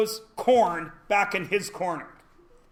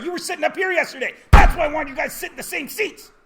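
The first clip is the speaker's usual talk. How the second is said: high, fast, loud protest; exasperated outburst; 7 semitones higher, 6 dB louder, faster than usual. Real, not acted. Teacher mock-scolding after forgetting a name.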